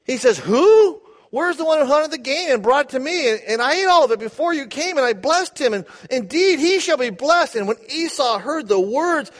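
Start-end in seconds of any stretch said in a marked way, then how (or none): 0.4-7.6 trembling Isaac. Acted. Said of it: "He trembled".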